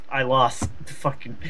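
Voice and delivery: ordinary talk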